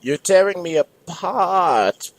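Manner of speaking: high, slow drawn-out cry; dying yell. Acted. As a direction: wail it while being torn apart. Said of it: "yelled a tourist".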